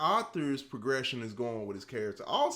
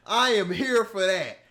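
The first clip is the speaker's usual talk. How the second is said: usual speaking voice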